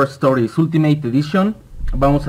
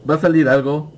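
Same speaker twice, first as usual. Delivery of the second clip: normal talking voice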